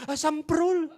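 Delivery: loud, high exasperated curse; umpatan kesal. Retold or imitated. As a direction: curse suddenly at a reckless driver. Mengumpat angkot yang ngerem mendadak. (mengumpat kesal dengan nyaring)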